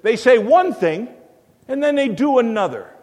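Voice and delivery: an intruder accusing the pastor, loud and high; loud accusation. Retold or imitated. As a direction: call out the hypocrisy at full voice. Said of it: "he looks at the pastor and he says".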